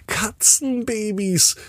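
very high, slow squeal. Acted. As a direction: say it in a high, delighted voice. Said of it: "sagt Wilma mit hoher Stimme".

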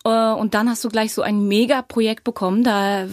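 usual speaking voice

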